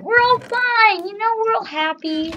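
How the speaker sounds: loud, high fake happiness; forced cheer. Player pretending the game is fine, forced cheer.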